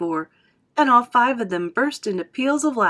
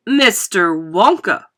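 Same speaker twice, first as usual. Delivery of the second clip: loud, slow, indignant address; emphatic objection; crying out; 8 dB louder, slower than usual. A narrator's reading. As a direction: cut in with drawn-out protest. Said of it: "cried Missus Salt".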